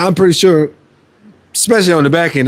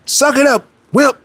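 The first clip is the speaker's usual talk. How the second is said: a touch of tough self-scolding, higher; self pep talk; 8 semitones higher than usual. Retold or imitated. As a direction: bark at yourself to keep going. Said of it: "I talk to myself like".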